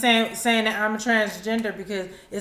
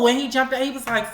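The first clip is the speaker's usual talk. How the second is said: usual speaking voice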